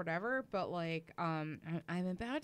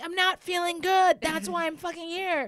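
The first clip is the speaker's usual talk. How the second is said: high, loud, fed-up retort; exasperated outburst; 11 semitones higher, 8 dB louder than usual. Retold or imitated. To the doctor asking how she feels: an exasperated loud high retort. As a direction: snap it back at him, fed up.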